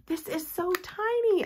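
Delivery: high excited squeal of real delight. Real, not acted. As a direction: gush over how tiny it is. Squealing over tiny handpiece.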